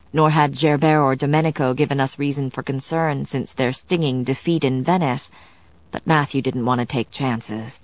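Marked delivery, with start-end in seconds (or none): none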